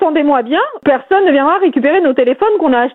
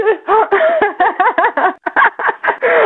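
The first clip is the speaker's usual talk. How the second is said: amused laugh; 4 semitones higher than usual. Real, not acted. Victim laughs at the prank.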